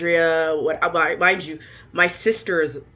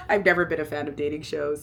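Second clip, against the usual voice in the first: chuckling confession; laughing apology; faster than usual. Real, not acted. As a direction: repeat it through a laugh. Repeats line laughing.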